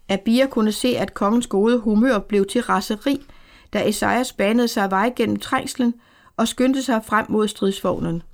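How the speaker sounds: plain reading voice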